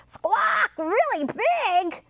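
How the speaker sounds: high parrot squawk, questioning; screechy storybook parrot. A narrator's reading. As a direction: squawk in high-pitched disbelief. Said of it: "said the parrot".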